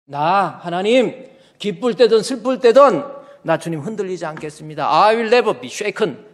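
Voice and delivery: loud, high, emphatic vow; resolute declaration. Shown as a demonstration. Showing the congregation how to vow to God, resolute and loud. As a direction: declare it forcefully with unshakable will. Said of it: "이라 해야 돼요".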